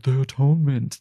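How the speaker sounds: put-on, grandly airy imitation; mock reverence. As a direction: mimic people saying it with exaggerated awe. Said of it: "Why are we like".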